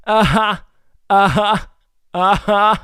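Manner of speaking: loud, rising mock agreement; sarcastic uh-huh at a bad pun. Real, not acted. Sarcastic reply to chat pun.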